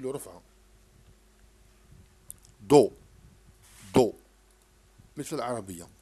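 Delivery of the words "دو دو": دو called out much louder, crisp; loud model syllable. Shown as a demonstration. Teacher drilling a child on a syllable, loud. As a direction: hit دو hard so the child copies it. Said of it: "مثل العربية عندما نكتب حرف الدال".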